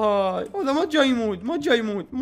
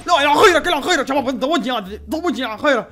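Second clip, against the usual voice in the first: exasperated yelling; 5 semitones higher, 9 dB louder than usual. Real, not acted. Strong zombie overwhelms him.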